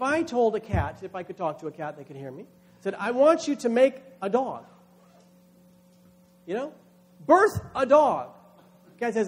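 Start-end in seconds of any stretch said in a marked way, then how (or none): 2.9-4.6 commanding voice. Retold or imitated. Preacher voicing command to cat. 7.2-8.3 slow, loud, high order to birth; slow loud command. Retold or imitated. Preacher commanding the cat, slow loud high order.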